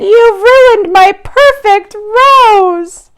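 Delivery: high, slow wail over the rose; band leader crying out. Acted. Band leader wails over his ruined rose. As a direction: cry it out like a heartbroken band leader. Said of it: "the band leader cried".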